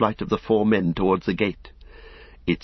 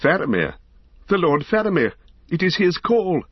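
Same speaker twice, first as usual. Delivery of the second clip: much higher, joyful recognition of the call; excited cry; 9 semitones higher than usual. A narrator's reading. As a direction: cry out as hope returns. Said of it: "cried Beregond".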